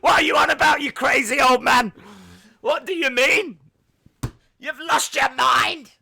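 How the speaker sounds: hammed-up exasperated shout; mock outraged shout. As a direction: berate a crazy old boss. Mocking staff yelling at Vince.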